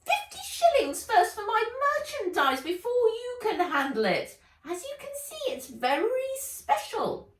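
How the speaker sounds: very high, loud haggling elf; greedy elf. Acted. Elf demanding payment from Nat, high and greedy. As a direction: drive a hard bargain. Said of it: "greedy, beady eyes".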